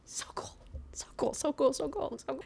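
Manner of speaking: breathy deadpan whisper, mocking the plot; sarcastic whisper. Real, not acted. Whispering sarcastically to viewers about the dark plot. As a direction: hush it, dripping with sarcasm.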